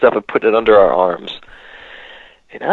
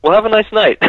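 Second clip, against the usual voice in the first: breezy guard; 8 semitones higher than usual. Retold or imitated. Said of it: "he was just like".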